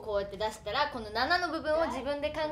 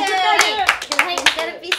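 gyaru voice; 4 semitones higher, 10 dB louder than usual. Shown as a demonstration. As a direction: hype it up like a gyaru. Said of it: "読み方もギャルでお願いします".

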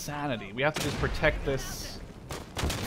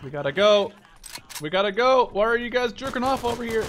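louder, rising push to teammates; urgent urging; 8 semitones higher, 4 dB louder than usual. Real, not acted. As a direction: hurry them along.

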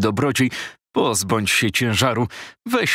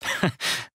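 soft breathy chuckle; 6 dB quieter than usual. Acted. Visitor laughs before reply.

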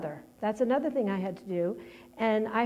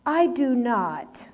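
retold inner protest, louder and drawn out; indignant denial; 2 semitones higher, 5 dB louder, much slower than usual. Daughter silently objecting to being like her father, indignant. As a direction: push back slowly and firmly, as remembered. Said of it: "And I thought".

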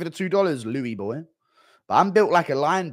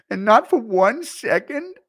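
1 Schmitty catchphrase; 9 semitones higher, 6 dB louder than usual. Retold or imitated. Echoing the rant line.

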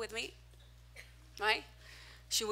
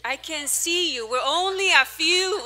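calling out; 5 semitones higher, 10 dB louder, slower than usual. Real, not acted. Calling out to congregation.

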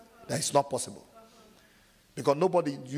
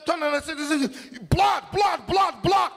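much higher, louder rising cries of blood; panicked shout; 13 semitones higher, 6 dB louder than usual. Retold or imitated. Shot man seeing his own blood, a panicked shout. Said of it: "You say".

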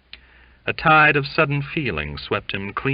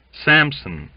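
usual speaking voice

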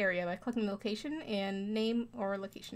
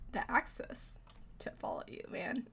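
whispered, slower aside; 9 dB quieter than usual. Real, not acted. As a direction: breathe it as a sneaky aside. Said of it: "whispered aside".

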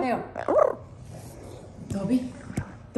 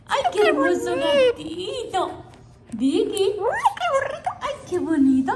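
high pet talk; 9 semitones higher, 3 dB louder than usual. Real, not acted. Owner cooing loudly and high at her kitten. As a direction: coo over the kitten, loud and high. Talking to the pets.

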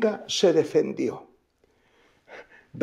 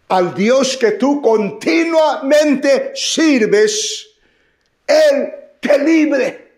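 high, slow, shouted king's blessing; 8 semitones higher, 4 dB louder, slower than usual. Acted. Preacher shouts the king's words to Daniel, slow and loud. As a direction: proclaim it at full force to Daniel.

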